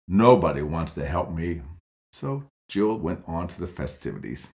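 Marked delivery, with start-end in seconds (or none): 0.1-1.6 low, louder self-pitying grumble; grumbly. Acted. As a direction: sulk it out bitterly. Man sulks again.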